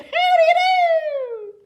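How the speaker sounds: high, loud squeaky creature voice; Thing voices. Acted. Things greet the children, high and squeaky. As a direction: chirp the greeting brightly. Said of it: "They said".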